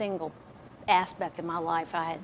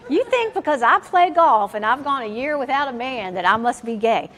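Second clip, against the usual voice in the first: louder, laughing indignant comeback; mock outrage through a laugh; retold indignant retort; 5 semitones higher, 9 dB louder than usual. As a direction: throw his question back at him. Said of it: "I was just like".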